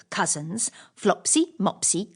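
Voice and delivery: ordinary delivery, nothing marked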